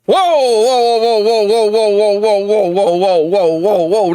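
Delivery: long running string of whoas, sliding down; shocked reaction. Real, not acted. Reader reacting to a shocking twist, stunned whoas. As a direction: chain them together without a break, in alarm.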